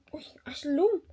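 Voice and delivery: whispered teasing; hushed playful whisper. Real, not acted. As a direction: breathe it as a whisper. Whisper to baby.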